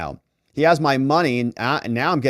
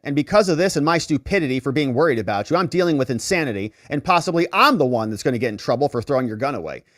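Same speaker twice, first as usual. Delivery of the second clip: rushed reading; faster than usual. Retold or imitated. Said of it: "panicked text voiced".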